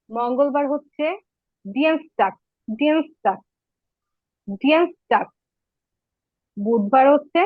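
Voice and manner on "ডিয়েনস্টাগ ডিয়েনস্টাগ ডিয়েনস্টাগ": ডিয়েনস্টাগ as German Dienstag, deens-tahg; German Tuesday. Shown as a demonstration. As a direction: model ডিয়েনস্টাগ in German, repeated. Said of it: "উচ্চারণ করে দেই".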